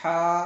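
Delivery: ہا loud with held "aa"; stretched ہا. Shown as a demonstration. Teacher models one-count stretch, ہا loud and held. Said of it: "ایک الف کھینچیں گے".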